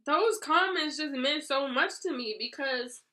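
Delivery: high, tearful voice, close to breaking; talking through real tears; words squeezed out through sobs; real crying. Real, not acted. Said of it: "I wanna stop crying".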